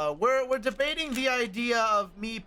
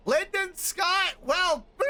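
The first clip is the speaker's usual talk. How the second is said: shout; 11 semitones higher, 4 dB louder, much slower than usual. Acted. Character calls out shouting.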